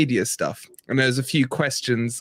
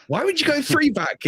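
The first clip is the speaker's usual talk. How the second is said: incredulous; 13 semitones higher than usual. Retold or imitated. Teasing teammate about formation.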